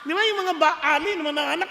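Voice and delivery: ordinary delivery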